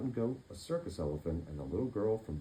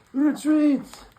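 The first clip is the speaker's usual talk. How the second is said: very high, loud panicked shout; panicked cry; 13 dB louder than usual. Acted. Startled pirate captain crying retreat, high and loud. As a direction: yelp it in fright. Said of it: "the captain cried".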